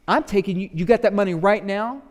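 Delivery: loud, high, pushy demand for the money; loud and high, shaking down a debtor; demanding creditor. Acted. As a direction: lean on him hard for the fiver. Servant demanding repayment from debtor.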